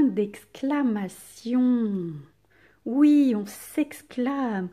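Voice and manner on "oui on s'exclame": usual speaking voice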